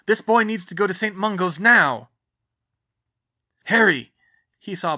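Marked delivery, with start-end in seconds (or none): 0.1-2.1 loud, high demand; urgent order. Acted. 3.6-4.1 loud, high call of his name. Acted. Sirius calling out to Harry.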